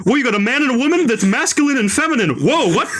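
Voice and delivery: high, hammed-up voice, laughing through it; mock Godard film dialogue. Critic parodying Nouvelle Vague's winks, high and laughing. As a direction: camp up the clunky name-drop line. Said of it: "That is also a Say That Again type movie".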